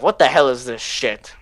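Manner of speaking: ordinary delivery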